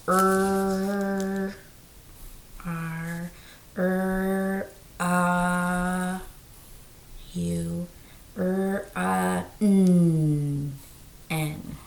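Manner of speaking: drawn-out sounds of Run then their letters R, U, N; sounding out letters. Shown as a demonstration. Teacher sounds out run while writing letters. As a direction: hold each sound, then name its letter. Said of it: "So that's three sounds".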